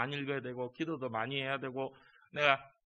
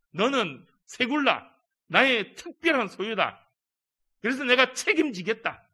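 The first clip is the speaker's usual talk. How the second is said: voicing God; 6 semitones higher, 7 dB louder than usual. Preacher voicing God's promise.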